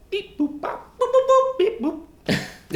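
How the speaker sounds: high beeping robot noises. Pretend. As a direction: chirp it out like a toy robot. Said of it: "Good luck, Miles Bot".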